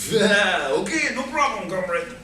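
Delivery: put-on playful Russian accent. Comic agreeing with a Russian buddy, in a Russian accent. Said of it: "I do a, a neat accent".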